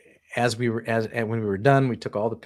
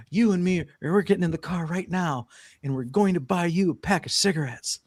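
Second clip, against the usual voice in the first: higher-pitched, firm mock orders; insistent wife imitation; 8 semitones higher than usual. Girlfriend ordering him out for cigarettes, mock insistent. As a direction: lay down the law in an imitated woman's voice. Wife lays down the law, retold.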